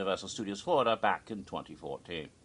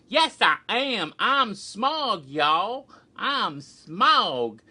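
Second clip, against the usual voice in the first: loud, high, hammed-up Southern drawl; proud Southern dragon insisting on who it is; Smaug, Southern accent; 9 semitones higher, 7 dB louder, much slower than usual. Dragon from The Hobbit answering doubters, loud Southern accent. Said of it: "you have a genteel southern accent".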